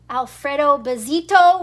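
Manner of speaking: ordinary delivery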